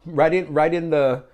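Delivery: playful challenge to offended parents; excited. Real, not acted. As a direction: egg the viewers on to comment. Goading viewers to comment.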